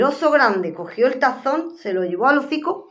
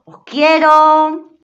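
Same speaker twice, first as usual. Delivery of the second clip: slow, drawn-out loving farewell; affectionate goodbye; 7 semitones higher, 11 dB louder, much slower than usual. Real, not acted. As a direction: send them a big hug. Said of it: "sign-off to listeners".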